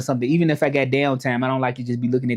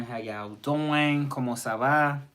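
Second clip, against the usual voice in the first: slow drawn-out greeting; 6 dB quieter, slower than usual. Shown as a demonstration. Greeting live viewers, slow and stretched. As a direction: stretch each word out slowly.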